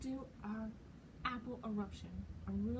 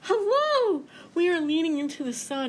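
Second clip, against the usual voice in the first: very high, sweet hello; a flower greeting the sun; very high, chirpy hello; 6 semitones higher, 4 dB louder than usual. Acted.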